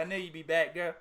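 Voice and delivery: imitating a smug pimp. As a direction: purr it like a self-assured pimp. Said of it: "pimp sound like that".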